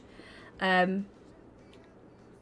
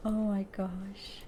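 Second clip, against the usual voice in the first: breathy whispered oh my gosh; whispering; 7 dB quieter, slower than usual. Real, not acted. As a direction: whisper it slowly, almost silent. Whispered repeat.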